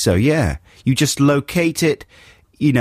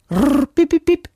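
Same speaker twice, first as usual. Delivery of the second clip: very high robotic beeps; pixie robot. Retold or imitated. Co-host shows how [name] sounds, as a robot pixie. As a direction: chirp the beeps like a tiny robot. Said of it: "little pixie robot from Iceland".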